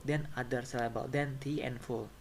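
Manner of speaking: ordinary delivery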